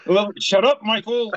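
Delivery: high, loud mock scolding; fake-snap at him for teasing; mock shut up. Scolding a cohost over a joke, hammed-up shut up.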